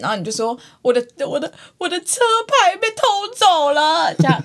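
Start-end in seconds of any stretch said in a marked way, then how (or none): 0.8-4.1 mock crying. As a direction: sob out the silly complaint. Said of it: "哭成这样".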